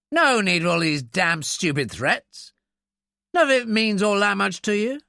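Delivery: high-pitched, huffy attempt at dignity; bluster. A narrator's reading. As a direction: bluster to cover the defeat. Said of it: "The attempt to bluster".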